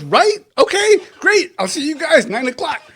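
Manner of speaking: high-pitched, upbeat quick confirmation; eager send-off. Acted. Promoter signing up the fighters, eager and high. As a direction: chirp it high, sealing the deal.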